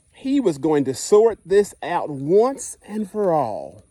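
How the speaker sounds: lively picture-book narration, dwelling on the punchlines; reading to children. Acted. Teacher reading Stuck to the class, storytime voice. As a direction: read it like storytime on the carpet.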